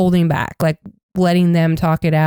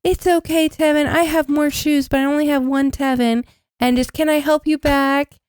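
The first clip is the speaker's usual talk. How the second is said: sweet Soda; 6 semitones higher than usual. Retold or imitated. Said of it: "And Soda's just like".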